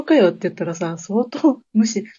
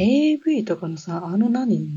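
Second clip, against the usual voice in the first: ordinary talk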